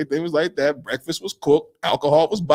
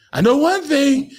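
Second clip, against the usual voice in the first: high, raised-voice threat in imitation; mock stern warning; 9 semitones higher, 4 dB louder than usual. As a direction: act out someone laying down the law. Retold warning over the window.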